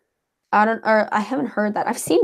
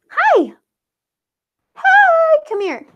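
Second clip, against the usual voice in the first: high-pitched dog voice; 11 semitones higher, 5 dB louder than usual. Real, not acted. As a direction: coo at the dog in a tiny high voice. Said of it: "that was kinda high pitched".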